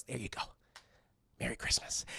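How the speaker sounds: low, very soft murmur; understated creepy near-whisper; hushed gift-giving. Retold or imitated. Krampus hands over the gift.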